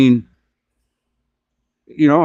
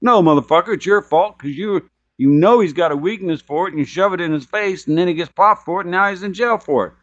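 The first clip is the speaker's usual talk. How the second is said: high-pitched, heated telling-off; indignant rebuke; 7 semitones higher than usual. Pretend. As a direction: scold the absent dad, pitch staying high. Rebukes the absent dad.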